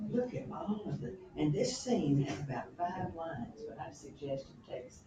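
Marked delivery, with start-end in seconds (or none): none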